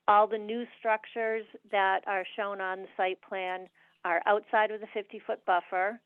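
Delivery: usual speaking voice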